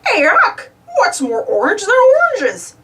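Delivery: goofy voice, laughing through it; laughing joke. Acted. Goofy jokes to Huck while driving, laughing goofy voice. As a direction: chuckle out the silly joke. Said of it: "he said with a laugh".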